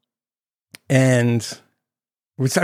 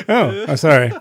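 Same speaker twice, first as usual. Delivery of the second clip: real laughing; 5 semitones higher, slower than usual. Real, not acted. Laughing at own joke.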